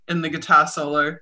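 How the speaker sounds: normal talking voice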